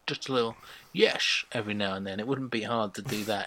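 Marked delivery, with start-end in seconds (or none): 0.9-1.4 "yes" ending in a sh sound; Dutch-style sh. Shown as a demonstration. Podcaster showing an easy Dutch accent, "yes" with a final sh. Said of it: "just put in a little sh".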